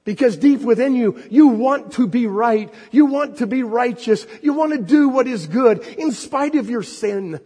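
high, loud pulpit voice; preaching at full force. Real, not acted. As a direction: lift the congregation with fervent preaching. Preacher building to a peak.

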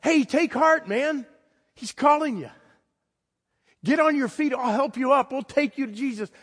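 mock the crowd's sudden friendliness; fawning crowd. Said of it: "the crowd said".

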